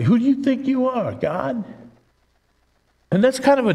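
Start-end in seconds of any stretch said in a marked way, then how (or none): 0.0-1.6 high mocking rise on who do you think you are; sneering challenge, pitched up; sarcastic. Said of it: "Someone says sarcastically".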